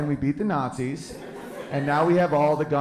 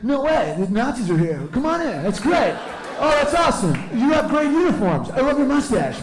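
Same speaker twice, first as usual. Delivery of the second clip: very high, loud, gushing host; shrieky, fawning delight, hammed up; 10 semitones higher, 4 dB louder than usual. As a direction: gush like an overexcited people-pleasing talk show host. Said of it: "They acted like Jimmy Fallon would have".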